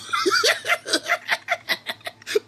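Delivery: breathy high-pitched cackle; shrill laughing fit. Real, not acted. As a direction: burst out in a squeaky laugh. Laughing at people.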